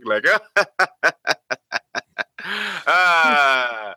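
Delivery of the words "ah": high cackling laugh from a severed head; evil cackle. Acted. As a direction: cackle like a gleeful monster. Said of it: "head sort of starts cackling".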